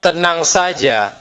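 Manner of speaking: very slow, drawn-out reassurance; slow calming. Real, not acted. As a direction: stretch every word out to soothe her. Officer calming a shouting woman.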